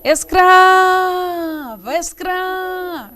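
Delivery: ESCRAVA called out high with a very long CRA; loud, sustained es-CRAAA-va; stressed syllable stretched. Shown as a demonstration. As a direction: hold the CRA as if calling from afar. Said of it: "Qual é a sílaba tônica?".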